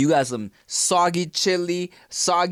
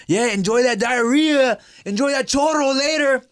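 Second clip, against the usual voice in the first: mocking taunt voice; 7 semitones higher, 4 dB louder than usual. Mocking Twitter critics.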